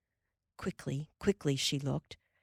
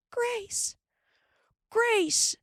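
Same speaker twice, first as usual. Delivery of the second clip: high call; 3 dB louder than usual. A narrator's reading. Mary calls from the shanty door, high call. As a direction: call from the doorway.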